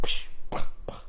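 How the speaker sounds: sharp mouthed pops and bursts; imitated popping sounds. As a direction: imitate glass popping in a fire. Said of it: "this popping sound".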